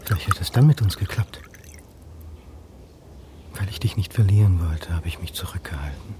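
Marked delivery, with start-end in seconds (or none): 3.5-6.1 whispered regret. Acted. Man whispering regrets to absent Anne, breathless whisper. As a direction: murmur it to a lost love.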